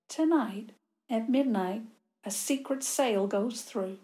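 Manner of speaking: ordinary delivery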